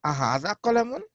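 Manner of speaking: أهذا قلم lifted at the end; rising question tune on قلم. Shown as a demonstration. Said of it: "You put a a in front of it".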